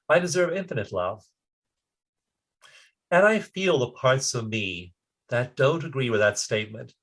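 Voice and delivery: calm self-love affirmation; guided affirmation. Acted. Leads tapping affirmations.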